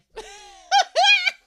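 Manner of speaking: sudden high cackling laugh; loud burst of laughter. Real, not acted. Host cracking up at the "Meh" edit, loud laugh.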